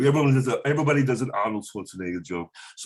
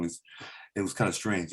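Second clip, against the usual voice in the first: ordinary talk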